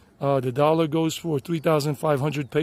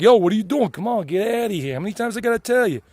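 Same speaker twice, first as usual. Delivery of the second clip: loud, brash New York accent, higher; New York Yankee accent; 6 semitones higher, 5 dB louder than usual. Shown as a demonstration. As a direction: play a tough Queens kid yelling at a buddy, New York accent. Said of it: "I can speak like a Yankee".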